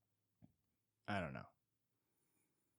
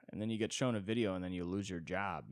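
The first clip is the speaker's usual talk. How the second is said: ordinary delivery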